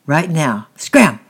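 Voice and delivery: sharp command. A narrator's reading. Tyke sends Francis running.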